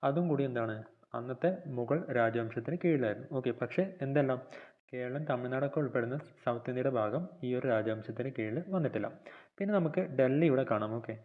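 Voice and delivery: ordinary talk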